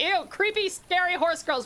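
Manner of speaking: mock disgust. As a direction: imitate people recoiling in distaste. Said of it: "Everyone's like".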